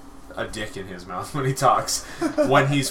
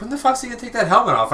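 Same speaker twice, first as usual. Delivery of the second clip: impatient; 10 semitones higher, 4 dB louder, faster than usual. Retold or imitated. Impatient kid watching movie.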